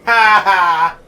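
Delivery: loud two-beat "ha ha"; mock gloating laugh. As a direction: laugh triumphantly at his misfortune. Gloats after the mimic joke.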